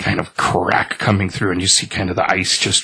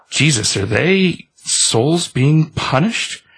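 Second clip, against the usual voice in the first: high, slow horrified question; horrified guy; 6 semitones higher, much slower than usual. Retold or imitated. Man to the reaper about the hands, high and slow horror. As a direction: drag out the shock, pitched up.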